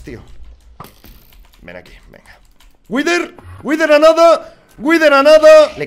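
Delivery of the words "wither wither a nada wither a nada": high, loud and drawn-out excited yell; gritando emocionado. Real, not acted. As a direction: scream it as the boss nearly dies. El jefe casi muerto. (grito eufórico al ver al Wither casi sin vida)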